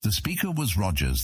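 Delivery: plain reading voice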